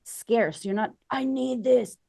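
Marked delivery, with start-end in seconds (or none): 1.1-2.0 higher, grasping "I need this"; mock neediness. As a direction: sound clingy and desperate. Acting scarce mindset.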